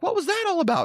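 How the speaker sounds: high imitation of the girls' question; high mock-girly quizzical voice; girls asking. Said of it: "And they're like".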